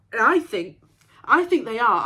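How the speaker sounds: loud, emphatic resolve; emphatic self-assurance; growing conviction. Acted. Resolves that orchids are possible.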